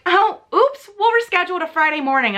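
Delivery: high, airy imitation of a careless booker; mock breezy apology. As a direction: toss off a mock "oops". Mimicking the breezy company reply.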